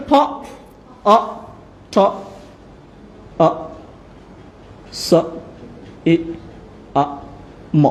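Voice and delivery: isolated letter sounds, one by one; sounding out. Shown as a demonstration. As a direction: spell it sound by sound with long gaps. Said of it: "এটা হচ্ছে".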